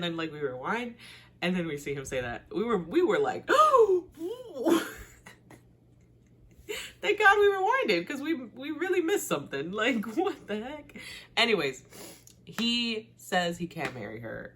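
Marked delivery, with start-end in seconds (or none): 3.5-5.0 loud high gasp of shock; retold sharp gasp. Shocked at missed line. 7.0-11.0 high, loud retold relief; replaying the rewind moment, excitedly shocked; excited disbelief.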